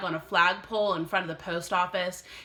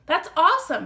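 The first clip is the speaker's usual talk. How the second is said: put-on excited boy; 9 semitones higher, 8 dB louder, faster than usual.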